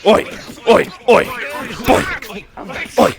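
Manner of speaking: short, loud repeated yelps; shrimp battle cry. Pretend. Shrimp horde attacks the fighters, yelling. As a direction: fire off each cry separately.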